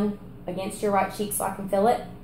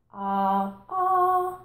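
pitch-changing ah; 11 semitones higher than usual. Shown as a demonstration. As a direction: jump the ah up high. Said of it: "change your pitch ... like this".

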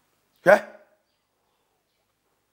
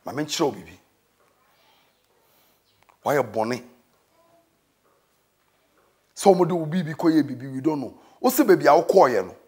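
soft pleading; 7 semitones lower, 6 dB quieter than usual. Acted. Preacher drops to soft pleading.

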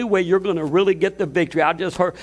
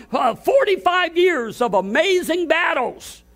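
preaching at full force; 7 semitones higher than usual. Acted. Pastor exhorting his congregation, rising to a full preaching shout. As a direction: preach it to the back row, building to a shout.

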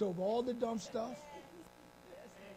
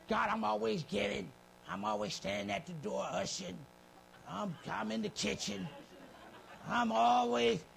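whiny boastful member; 2 semitones lower than usual. Retold or imitated. Mocking a boastful churchgoer.

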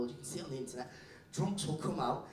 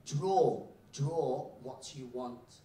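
very slow, drawn-out instruction; slow teacher; much slower than usual. Acted. Teacher handing a pen to a foreign kid, very slowly. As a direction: stretch it out word by word. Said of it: "she slowly says".

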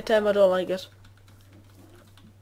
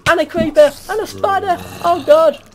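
loud, high panicked shouts; panicked yelling; 6 semitones higher, 8 dB louder than usual. Real, not acted. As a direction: scream it while fleeing mobs. Chased by zombies, yelling.

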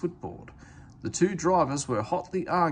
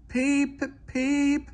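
high piping peep peep; engine whistle; 4 dB louder than usual. A narrator's reading. Sir Handel whistling at George to move aside, high piping toot. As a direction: toot a shrill little whistle.

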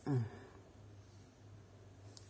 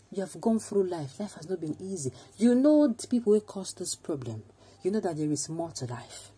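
mock friend rebuke; 4 semitones higher than usual. Voicing friend's frustrated rebuke.

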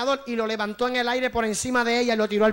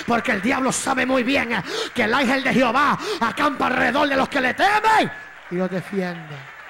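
fiery preaching; 4 dB louder than usual. Real, not acted. Preacher assures congregation of angelic protection, full-force preaching. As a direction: drive each phrase home to the congregation.

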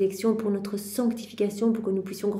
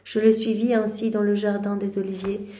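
louder, earnest devotional reading; fervent reading; 5 dB louder than usual. Acted. Prayer leader reading Mary's lament to the group, louder. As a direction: read it out with rising fervour. Reads the passage aloud.

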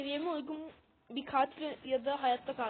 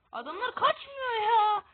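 whiny frustrated cry; 7 semitones higher, 6 dB louder, slower than usual. Real, not acted. Frustrated gamer whining at enemies.

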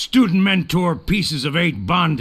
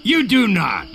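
put-on childish quarrel; 5 semitones higher, 4 dB louder than usual. Childish back-and-forth quarrel.

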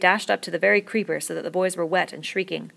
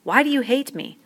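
despairing; 7 semitones higher than usual. A narrator's reading. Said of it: "he spoke despairingly".

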